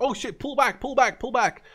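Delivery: high, hurried panicked retreat call; hammed-up alarmed orders; mock panic. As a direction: voice the player yelling to fall back. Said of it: "it's like".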